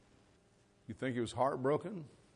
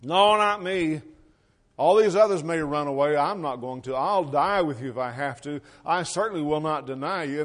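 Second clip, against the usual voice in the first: loud, emphatic vow of loyalty; adamant protest; 3 semitones higher, 8 dB louder than usual. Acted. Peter answering the Lord's warning, adamant and loud. Peter boasting he won't deny Jesus.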